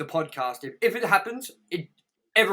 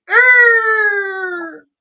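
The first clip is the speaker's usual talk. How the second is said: loud, high screeching eagle cry; 13 dB louder than usual. Retold or imitated. Mock eagle screech mocking the movie poster quote. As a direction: shriek it like the game's eagle. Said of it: "this one would just go".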